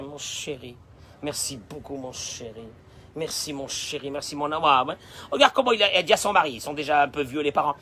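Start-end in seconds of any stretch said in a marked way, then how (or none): 0.0-4.9 soft, much quieter thanks; mother thanks her son, soft and tender. Acted. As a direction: murmur thanks like a doting mother.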